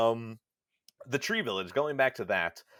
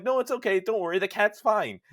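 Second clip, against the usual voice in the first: higher, louder comforting rush; reassuring; 9 semitones higher, 6 dB louder than usual. Retold or imitated. Husband reassuring his crying wife, in a high, urgent comforting voice. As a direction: soothe her that the cat is fine.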